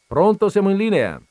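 loud, high call into the phone. Real, not acted. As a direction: call out to the caller on the line. Host calling out to phone caller.

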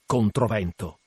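ordinary delivery, nothing marked

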